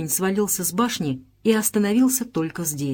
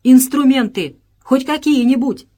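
loud, higher narrated plea; raised voice, pushed up high and hard; crying out; 5 semitones higher, 7 dB louder than usual. A narrator's reading. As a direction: call out urgently for any tools at all. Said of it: "вскричал он".

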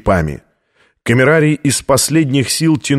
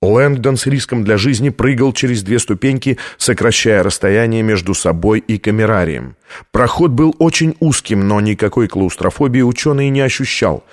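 ordinary delivery

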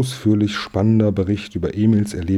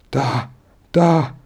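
high, halting exclamations, the second louder; excited stammer; 6 semitones higher, 4 dB louder than usual. A narrator's reading. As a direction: stammer it out in agitation, pitched high. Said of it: "stammelte sie". (aufgeregtes Stammeln)

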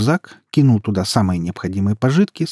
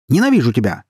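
loud, raised burst of hatred; angry, louder and higher; angry bark; 4 semitones higher, 5 dB louder than usual. A narrator's reading. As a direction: snap it right in their face. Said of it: "рявкнул он сестре в лицо".

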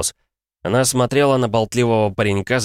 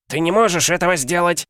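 roaring; 11 semitones higher than usual. Acted. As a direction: bellow it in outrage. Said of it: "взревел тот".

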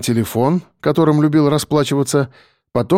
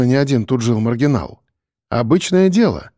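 usual speaking voice

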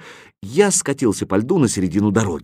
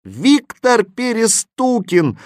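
chorus answer; 11 semitones higher, 3 dB louder, slower than usual. A narrator's reading. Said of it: "хором ответили".

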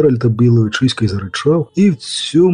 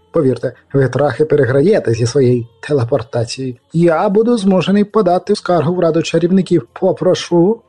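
pompous chamberlain; 5 semitones higher than usual. A narrator's reading. Chamberlain threatens complaint.

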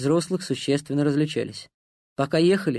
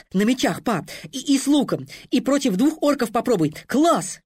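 put-on high, thrilled little boy; excited child; 10 semitones higher than usual. A narrator's reading. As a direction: squeal it out like a kid wanting games. Excited boy listing game wishes.

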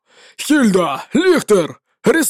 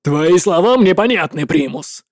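sharp; 3 semitones lower than usual. Acted. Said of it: "резко сказал Итан".

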